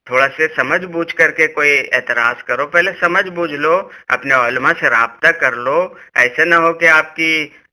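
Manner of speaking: usual speaking voice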